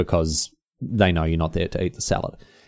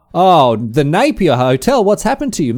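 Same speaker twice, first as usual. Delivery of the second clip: mock lament; 8 semitones higher, 7 dB louder than usual. Real, not acted. Dismay at the changed menu.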